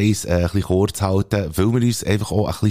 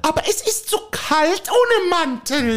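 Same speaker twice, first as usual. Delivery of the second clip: a higher, fussy housewife voice pestering her husband; nagging wife; 3 dB louder, slower than usual. Acted. Wife nagging her husband to go for a walk, fussy. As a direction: play the nagging wife in a comic sketch. Acted dialogue sketch, wife role.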